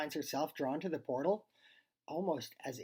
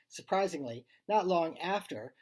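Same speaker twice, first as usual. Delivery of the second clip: plain reading voice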